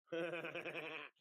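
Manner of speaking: loud, high laughing shout; loud burst of laughter. Real, not acted. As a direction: let out a big shouting laugh. No bacon on the sandwich.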